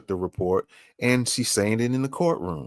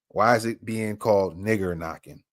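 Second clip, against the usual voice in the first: usual speaking voice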